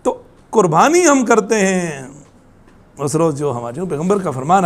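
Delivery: usual speaking voice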